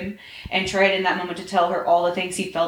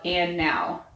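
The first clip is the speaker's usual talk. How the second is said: usual speaking voice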